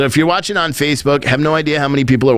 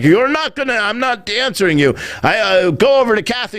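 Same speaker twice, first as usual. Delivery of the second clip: higher-pitched flustered brush-off; mock stonewalling, pitched up; mimicked refusal; 7 semitones higher than usual. Press secretary brushing off a reporter, mimicked refusal.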